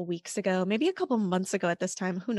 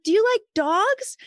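much higher and louder cheerful question; friendly woman offering her dog, high and bright; bubbly stranger; 13 semitones higher, 8 dB louder than usual. Retold or imitated. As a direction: coax the child with delight. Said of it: "And she was like".

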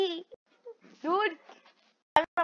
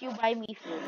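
ordinary talk

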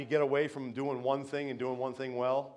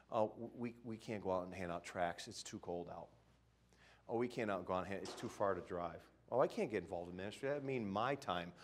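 mock excuses; 5 semitones lower, 9 dB quieter than usual. Mocking excuses of lazy Christians.

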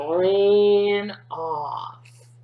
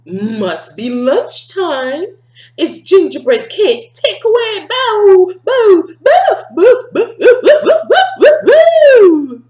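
high, loud barking dog; the dog spots gingerbread lunch, barking high and loud; 11 semitones higher, 12 dB louder than usual. Acted. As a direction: bark excitedly at lunch.

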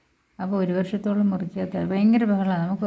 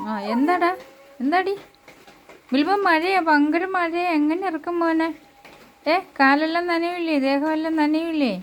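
pet talk; 6 semitones higher than usual. Real, not acted. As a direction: coo at the pet in a high voice. Owner talking to her dog.

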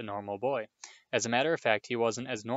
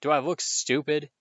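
loud, higher snarled retort; 4 semitones higher, 6 dB louder than usual. A narrator's reading. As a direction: snap back at the nephew. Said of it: "snarled Uncle Vernon".

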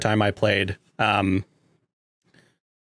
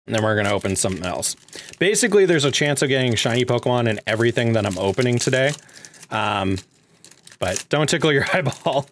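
ordinary delivery

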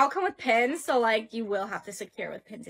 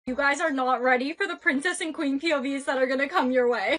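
ordinary delivery

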